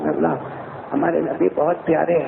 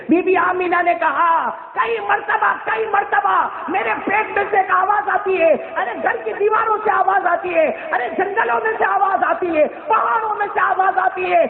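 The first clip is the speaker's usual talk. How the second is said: preaching at full force. Acted. Preacher recounting Amina to the gathering, full-force preaching. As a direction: preach it out at the top of the voice.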